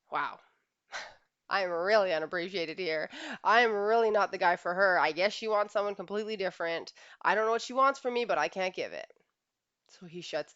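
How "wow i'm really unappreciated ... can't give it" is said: louder wounded protest with a bitter laugh; hurt husband. Retold or imitated. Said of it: "For him, it's".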